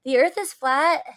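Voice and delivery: loud, high, confident outburst; excited exclamation. A narrator's reading. Student answering the teacher in class, exclaiming with confident excitement.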